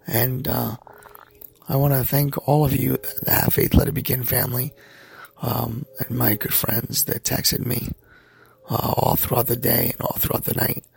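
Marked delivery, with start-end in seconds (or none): 0.1-0.8 barely voiced whisper. Real, not acted. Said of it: "I don't sound better". 8.7-10.8 breathy, half-voiced after surgery; weak after surgery. Real, not acted. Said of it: "I don't sound better".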